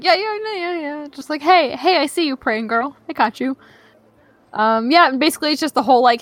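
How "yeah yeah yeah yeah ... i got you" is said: higher, casual dude voice; god answering the prayer like a friend; laid-back god voice. Retold or imitated. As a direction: play the god as a chill buddy.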